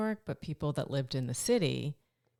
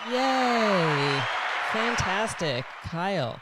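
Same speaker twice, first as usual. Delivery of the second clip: much louder, high cheer; excited celebratory yay; 4 semitones higher, 8 dB louder, much slower than usual. Real, not acted. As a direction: cheer for the good news. Celebrating listener's pregnancy news.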